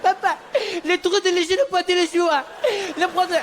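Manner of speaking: high, wailing mock-French child; tearful little French boy. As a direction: whine it high like a sobbing kid, hammed up. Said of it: "They're both in tears".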